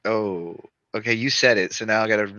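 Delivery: ordinary talk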